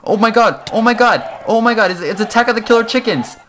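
high, loud frantic panic; panicked. Real, not acted. To the viewers while chickens attack, frantic high panic. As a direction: shriek it out in a frenzy.

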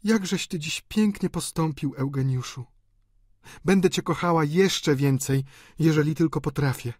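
high female character voice; affectionate lover. A narrator's reading. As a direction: praise fondly, then gently admonish. Delphine's character voice.

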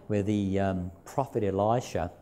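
ordinary delivery

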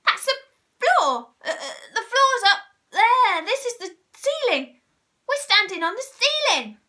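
high, loud, stammering alarm; panicked scream. A narrator's reading. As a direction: shriek it in mounting bewilderment. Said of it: "screamed Missus Twit".

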